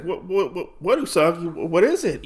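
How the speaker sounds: high, stammered questions in mild alarm; lightly acted bewilderment; flustered questioning. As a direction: act out a confused princess. Retold princess pushed away, confused.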